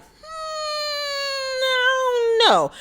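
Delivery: long, high-pitched squeaky hum of doubt; doubtful. Acted. Host reacting to the missing house alarm, doubtful. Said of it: "that to me was like".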